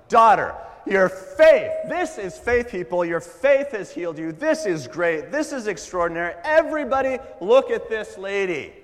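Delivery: excited Jesus. Retold or imitated. Jesus showing the healed woman to the crowd, jubilant. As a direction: shout it to the crowd like a thrilled preacher. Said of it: "this was incredibly excited Jesus".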